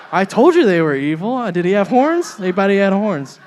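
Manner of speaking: high, loud mock outrage; shocked churchgoer gossiping, high and loud; mock outraged churchgoer. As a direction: play the horrified church lady.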